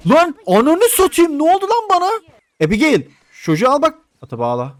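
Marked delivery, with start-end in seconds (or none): 0.1-2.1 loud, very high-pitched cursing; startled yell. Real, not acted. Gamer hit by a sudden mishap, startled yell. 2.7-4.0 loud, high shouted order; urgent calling out. Pretend. Gamer to Abigail about the child, urgent shout.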